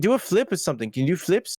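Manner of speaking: lightly imitating an Aussie asking for flips; Australian accent. Australian filmmaker asking if they can flip, mock Australian accent. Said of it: "like, Australian".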